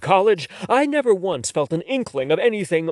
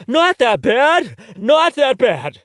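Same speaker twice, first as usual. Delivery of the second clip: higher, louder, slow mocking repeat; incredulous echo; 9 semitones higher, 6 dB louder, slower than usual. Heath echoes his friend's reassurance, incredulous. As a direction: throw his words back in disbelief. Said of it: "I've been outed".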